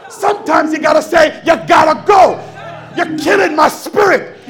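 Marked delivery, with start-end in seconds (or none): 1.5-4.4 loud, insistent send-off; a preacher shouting someone out of his way; shouted dismissal. Acted. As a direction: order them out with full force. Preacher acts out dismissing doubters.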